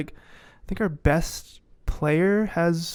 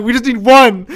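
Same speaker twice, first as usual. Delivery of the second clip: pleading team; 7 semitones higher, 11 dB louder than usual. Retold or imitated. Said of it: "We're all like".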